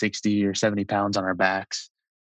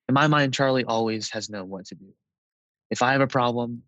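usual speaking voice

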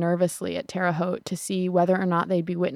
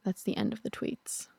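whispered aside; 9 dB quieter than usual. Real, not acted. Quiet aside after reading tweets.

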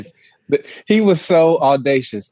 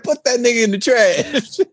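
high laughing impression; 9 semitones higher than usual. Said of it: "She said".

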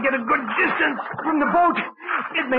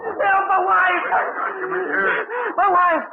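calling for help; 7 semitones higher, much slower than usual. Acted. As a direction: yell across the water for rescue.

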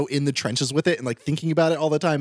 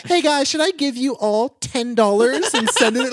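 influencer voice; 8 semitones higher, 4 dB louder than usual. Retold or imitated. Imitating influencer post.

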